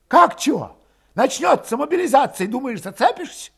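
high, agitated challenge; heated argument. A narrator's reading. Valet warning a reluctant comrade of mobilization, agitated. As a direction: argue back high and heated.

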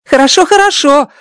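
happy little alien; Rocky relieved and pleased; glad alien. Acted. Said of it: "радуется он".

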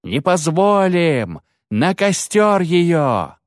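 high, slow crowd bellow; mob shout. Acted. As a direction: roar it with the lynch mob. Mob cries out for burning.

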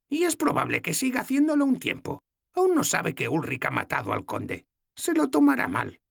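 voiced little old woman, amused; old goddess's voice. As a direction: tease the kissing couple. Logna voiced.

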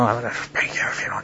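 hushed warning whispered into an ear; whispered threat. Acted. Parent warning a child in Walmart, whispering. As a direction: whisper it so nobody else hears. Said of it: "I'm whispering in his ear".

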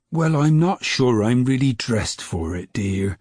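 ordinary delivery, nothing marked

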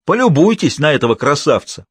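loud, high triumphant gloating. A narrator's reading. Boss shows the drunk surgeon, triumphant. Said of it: "победоносно изрёк Семён Семёныч".

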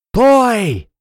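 high, loud, long cry; crowd shout. A narrator's reading. Someone in the crowd as people tumble, shouting. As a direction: yell out over a brawl. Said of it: "закричали в толпе".

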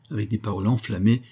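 plain reading voice

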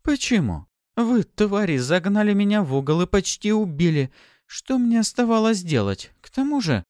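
high-pitched, indignant self-justification; heated accusation. A narrator's reading. Pafi blaming those who cornered her, indignant. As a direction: argue back in a high, heated voice.

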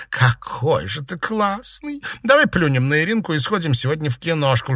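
high, playful wheedling; flirty Seraphima. Acted.

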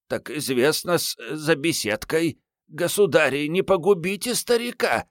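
put-on high-pitched, pleading old servant; испуганная мольба старика. A narrator's reading. Затрясшийся ключник молит о пощаде. (жалобно и высоко, умоляющий старик)

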